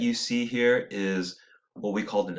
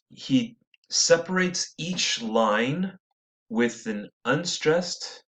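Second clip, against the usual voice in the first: ordinary talk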